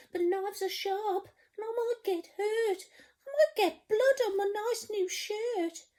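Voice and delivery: high, trembling scared cook voice. Acted. Storyteller voicing the cook, scared of the sea, high voice. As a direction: quiver like a frightened wimp. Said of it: "the cook declared".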